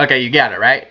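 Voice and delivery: normal talking voice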